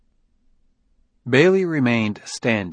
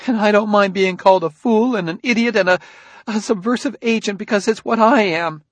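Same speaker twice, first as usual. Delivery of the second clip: distraught; 11 semitones higher, 5 dB louder, faster than usual. A narrator's reading. Jessie bitter outburst.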